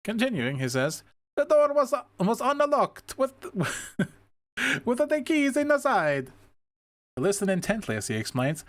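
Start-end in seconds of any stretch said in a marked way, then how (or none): 1.4-6.3 high, hammed-up Italian accent with a chuckle; thick Italian accent, breaking into a laugh; heavy Italian accent. Officer Gillespie explaining the unlocked car, in a heavy Italian accent. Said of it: "It is heavy Italian accent".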